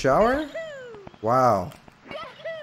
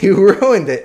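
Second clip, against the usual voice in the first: laughing complaint; 4 semitones higher, 6 dB louder than usual. Real, not acted. Laughing while repeating complaint.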